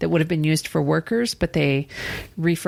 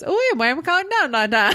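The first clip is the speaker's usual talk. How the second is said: put-on garbled Louisiana accent; 11 semitones higher, faster than usual. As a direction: run it all together like you can't make out a word, ending in a laugh. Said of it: "And what I heard was".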